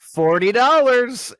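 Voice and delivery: high, loud, slow mock sales pitch; a hammed-up high drawn-out offer; imitating the woman. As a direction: drag out the price like a hawker. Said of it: "And she goes".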